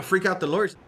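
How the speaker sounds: usual speaking voice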